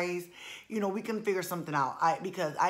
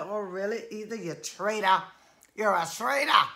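mock accusing; 5 dB louder than usual. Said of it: "I said".

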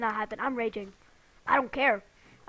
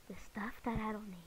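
whisper; 4 semitones lower, 9 dB quieter than usual. Real, not acted. Aside to viewers.

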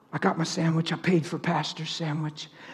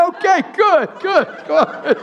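higher and louder through laughter; laughing quote; 14 semitones higher, 7 dB louder, slower than usual. Retold or imitated. Retelling his reply to the cashier, laughing. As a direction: chuckle the words out. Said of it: "I said".

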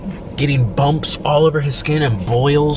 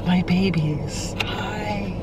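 soft, slow, higher coo to his plants; tender baby talk; 6 semitones higher, 5 dB quieter, much slower than usual. Retold or imitated. Boyfriend cooing softly at his plants. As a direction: coo softly like greeting newborns. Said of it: "And he went like this".

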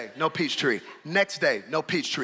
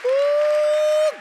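loud, high preaching, nearly shouting; fiery preacher stirring the congregation; preaching at full force; 11 semitones higher, 6 dB louder than usual. Acted. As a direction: whip the church up with shouted calls.

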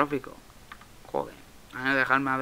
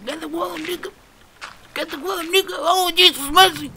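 very high mimicry of panicked yelling; high put-on voice rising to a shout; imitating shouting; 8 dB louder than usual. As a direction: mimic their frantic cries, loudest at the end. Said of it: "Y empiezan a gritar".

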